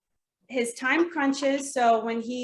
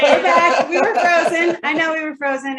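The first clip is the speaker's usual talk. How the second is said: excited; 6 semitones higher, 11 dB louder than usual. Real, not acted. Call drops, reconnects excitedly.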